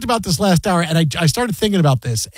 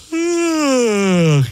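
long, showy mock yawn; demonstrated yawn; 6 semitones higher than usual. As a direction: yawn high and let it slide slowly down. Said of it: "just went, Hey, look at this".

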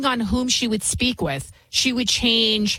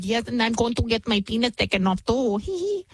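mocking impression. Host mimics the man from the report, mocking lisp. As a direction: mimic him with a silly lisp and tee-hee. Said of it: "And then he was like".

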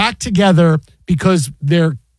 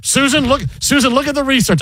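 exasperated announcer voice; 7 semitones higher than usual. Retold or imitated. Voicing John Sterling exasperated.

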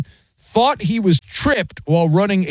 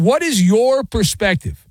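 ordinary talk

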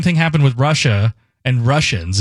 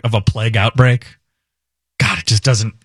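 usual speaking voice

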